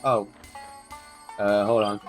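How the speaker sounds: normal talking voice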